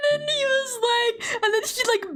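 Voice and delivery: high, giggly retelling; laughing while talking. Real, not acted. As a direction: struggle to tell it through the giggles. Still laughing while retelling movie.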